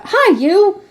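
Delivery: high, loud hail; calling out. Acted. Hunchback boy calling Marco back, high loud hail. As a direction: call him back across the street. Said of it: "he called out".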